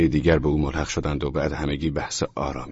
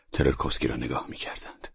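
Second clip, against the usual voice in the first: usual speaking voice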